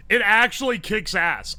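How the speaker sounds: ordinary delivery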